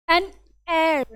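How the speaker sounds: "An air" with a silent h, a pause before "air"; demonstrated HEIR pronunciation. Shown as a demonstration. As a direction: model "air" as its own clear word after "An". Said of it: "इसका pronunciation is this". ("An air" में h चुप, साफ़ उच्चारण)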